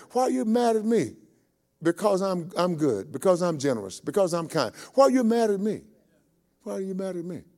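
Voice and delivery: high-pitched East Texas protest; East Texas vernacular. Acted. Vineyard owner answering griping workers, East Texas vernacular. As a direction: plead it in an East Texas twang. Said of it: "let me put it in East Texas vernacular".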